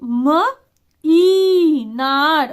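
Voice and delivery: drawn-out sounding out of म ई नार, very slow; spelling it out slowly. Shown as a demonstration. Teacher sounding out a word for parents, very slowly. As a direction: stretch each sound apart for a child. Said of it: "बड़ी की मात्रा है वो थोड़ी दूर distance में लिखी हुई है".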